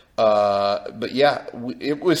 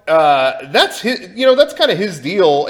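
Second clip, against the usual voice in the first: normal talking voice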